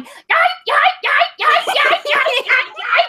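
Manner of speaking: shrill repeated yelps; high squeal. Pretend. Vagina leaving body squealing.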